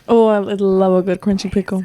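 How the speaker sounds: normal talking voice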